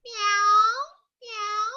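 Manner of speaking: high, drawn-out meows begging for food; hungry cat meow. Shown as a demonstration. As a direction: mew like a cat asking to be fed. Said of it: "if the cat wants food".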